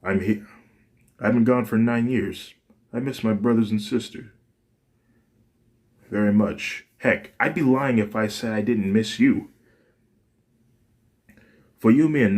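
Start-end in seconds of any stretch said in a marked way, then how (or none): none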